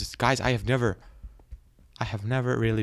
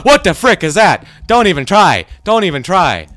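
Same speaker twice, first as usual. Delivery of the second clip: high, loud warning yells; panicked shouting; 7 semitones higher, 11 dB louder than usual. Real, not acted. Player warning off an attacking monster, panicked shouting.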